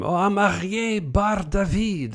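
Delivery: high, slow, grand announcement; name with oomph. Retold or imitated. Tour guide introducing himself to Joe, with oomph. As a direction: imitate a guide proudly rolling out his name. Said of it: "didn't have the same oomph".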